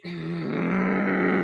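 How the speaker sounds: long, drawn-out frustrated mm; frustrated groan. Real, not acted. Fan upset that Luke's work is undone, long frustrated groan. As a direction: groan through closed lips, holding it long. Exasperated groan about Luke retcon.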